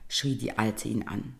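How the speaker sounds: plain reading voice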